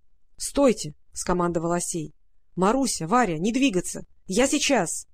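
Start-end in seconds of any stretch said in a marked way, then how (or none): none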